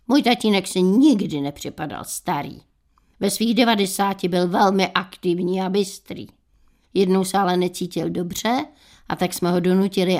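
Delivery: usual speaking voice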